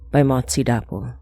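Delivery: ordinary delivery, nothing marked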